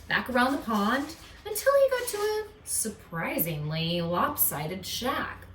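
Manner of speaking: ordinary delivery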